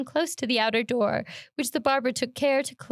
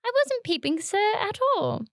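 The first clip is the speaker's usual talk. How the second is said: frightened; 9 semitones higher than usual. Acted. Frightened boy denies.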